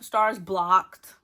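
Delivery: loud, stunned announcement; shocked reveal. Retold or imitated. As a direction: drop the news like a bombshell.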